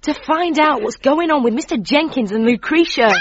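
normal talking voice

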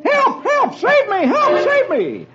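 very high, panicked cries; frantic yell for help. A narrator's reading. Man running up the road to Roy, frantic yell for help. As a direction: cry out to be rescued, high-pitched. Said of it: "yelling for help".

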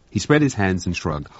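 ordinary delivery, nothing marked

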